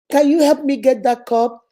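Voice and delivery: normal talking voice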